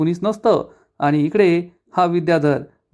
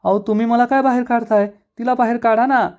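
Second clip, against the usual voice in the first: much higher, fast indignant cry; indignant protest; 7 semitones higher, faster than usual. A narrator's reading. Wife protesting to her husband, higher and indignant. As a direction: protest being thrown out yourself. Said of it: "Dhanashree चांगली चिडते".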